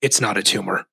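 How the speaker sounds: put-on low, subdued action star without accent; deadpan it low, minus the accent; Arnold Schwarzenegger. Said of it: "but then his voice is just".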